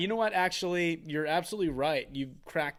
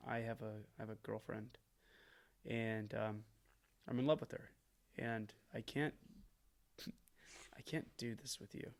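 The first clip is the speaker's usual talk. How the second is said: mock earnest confession; 4 semitones lower, 8 dB quieter than usual. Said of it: "literally just being like".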